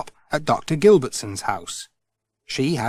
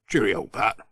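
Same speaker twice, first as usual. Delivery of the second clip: gruff Ted; 4 semitones lower than usual. Acted. Ted says goodbye back to Pat, lower voice. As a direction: answer him low and plain.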